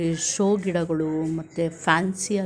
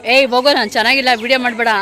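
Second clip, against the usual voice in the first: loud mock protest, a bit higher; imitating sister's protest; 4 semitones higher, 10 dB louder, faster than usual. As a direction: shoo the camera away, playing big sister. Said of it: "ಅಂತ ಹೇಳ್ತಾ ಇದ್ದಾಳೆ". (ಅಕ್ಕನ ಥರ ಜೋರಾಗಿ ಅಣಕಿಸಿ)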